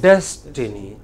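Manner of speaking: "Destiny" with "Des" prominent, rest light; stress on DES. Shown as a demonstration. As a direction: hit "Des" and let "tiny" drop away. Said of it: "des is given prominence".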